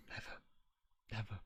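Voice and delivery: ordinary talk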